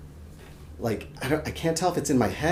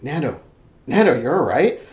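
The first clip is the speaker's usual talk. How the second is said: lightly acted concerned roommate, rising question; mock worried check-in; alarmed roommate; 4 semitones higher, 6 dB louder than usual. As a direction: call his name, then check on him, a little raised. Roommate wakes him from screaming.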